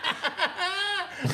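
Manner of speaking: a sudden high squeal of laughter; high burst of laughter. Real, not acted. Host laughing at his guest's horror story joke. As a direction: crack up at the idea.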